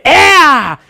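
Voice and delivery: loud, high ringing cry of ea; victory shout. Shown as a demonstration. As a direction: blast it out in triumph. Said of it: "es un grito de". (grito de victoria, fuerte y agudo)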